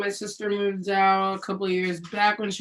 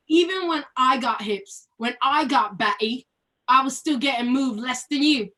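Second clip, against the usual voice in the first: put-on fast, heated London-style British accent rant. Ranting at her friend in the play, British accent. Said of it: "read it in a British accent".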